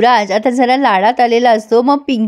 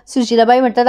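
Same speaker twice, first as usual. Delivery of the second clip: plain reading voice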